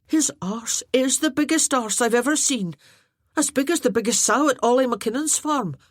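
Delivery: lightly voiced Finian, teasing; cheeky jester. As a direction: deliver the insult with a grin. Finian jests about Arter.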